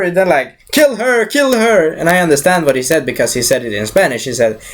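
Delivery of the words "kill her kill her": high-pitched henchman's order; imitating an urgent henchman; urgent command. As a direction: urge it on, pitched well up. Acted henchmen urging a kill.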